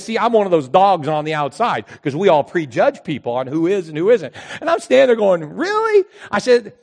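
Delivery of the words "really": high, loud mock disbelief; really, you're kidding me; incredulous. Incredulous retort.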